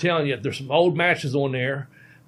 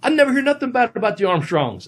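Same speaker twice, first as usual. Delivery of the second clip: high, loud mock British accent; a failed attempt at a British accent, high and indignant; bad British accent; 10 semitones higher, 4 dB louder than usual. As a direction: squawk it in a botched British accent. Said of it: "I can't do a British accent".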